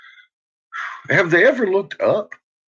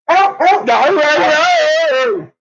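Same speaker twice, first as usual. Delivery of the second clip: much higher and louder, yelping no at the dog; alarmed yelping; 13 semitones higher, 6 dB louder, much slower than usual. Real, not acted. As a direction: cry out in alarm. Reacting to the dog.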